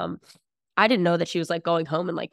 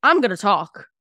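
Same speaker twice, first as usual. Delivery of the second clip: put-on defiant kid; 2 semitones higher, 7 dB louder, slower than usual. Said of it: "And I was like".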